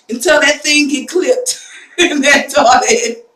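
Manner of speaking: loud words breaking into laughter; real helpless amusement; laughing. Real, not acted. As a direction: crack up while finishing the sentence. Laughing about son's toilet mishap.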